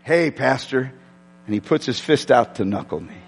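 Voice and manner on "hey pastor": a grinning friend saying hi; friendly hello. Retold or imitated. Nate greeting his pastor, low friendly hello. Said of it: "There's Nate".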